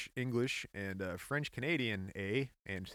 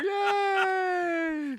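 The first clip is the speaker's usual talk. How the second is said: cheer; 7 dB louder than usual. Real, not acted. Celebrating correct guess.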